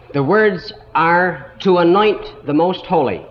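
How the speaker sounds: ordinary delivery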